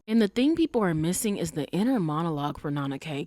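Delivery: usual speaking voice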